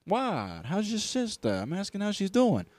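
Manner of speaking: high, loud Italian-American protest; affronted Italian-American wise guy. Acted. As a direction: do a hammed-up, high-pitched Italian objection. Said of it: "we're getting Italian on this one".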